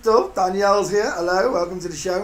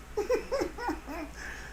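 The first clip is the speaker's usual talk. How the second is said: short high laugh; a high surprised giggle; 10 dB quieter than usual. Real, not acted. Laughing at the viewer's unreadable name.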